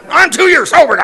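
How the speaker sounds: high, loud protesting cry; indignant shout back. Retold or imitated. Answering the jeering drivers in his head, loud indignant cry. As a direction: shout it back at the honking cars. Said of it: "And I'm like".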